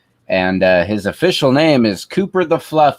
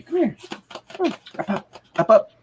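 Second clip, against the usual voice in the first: coaxing the dog; 10 semitones higher than usual. Real, not acted. Owner coaxing his scared puppy up the stairs, high sing-song. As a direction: sweet-talk the puppy up the stairs.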